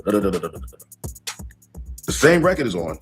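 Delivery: usual speaking voice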